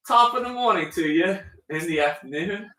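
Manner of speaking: cheerful fake British accent; mock British accent. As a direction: play a jolly Brit badly. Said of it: "British accents only".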